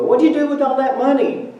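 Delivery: high, loud, put-on taunting voice; teasing questioner. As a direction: set up the joke like a smug heckler. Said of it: "those people who go".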